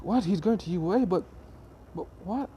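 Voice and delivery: mildly acted astonishment; disbelieving classmates. Classmates hearing he's applying to U A, incredulous.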